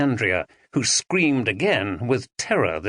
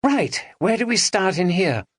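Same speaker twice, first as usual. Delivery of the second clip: Maggie asking; 8 semitones higher than usual. A narrator's reading. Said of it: "asked Maggie".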